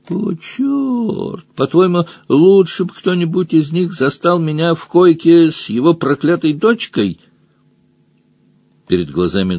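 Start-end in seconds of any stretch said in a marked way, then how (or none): 0.1-7.2 high-pitched indignant comeback; exasperated protest. A narrator's reading. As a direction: argue back, cursing in exasperation. Otter protests against accusation.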